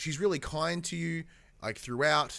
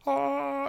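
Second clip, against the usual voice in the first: high sung heavenly ah; a bright choir note of light; angelic choir ah; 14 semitones higher, 4 dB louder than usual. Retold or imitated. Angelic glow in game.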